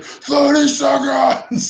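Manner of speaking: drawn-out high-pitched mock yell; Jason Statham yell. Film fan quoting a favourite throwaway line, imitated yell. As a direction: stretch it into a high imitated holler. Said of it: "where Jason Statham yells".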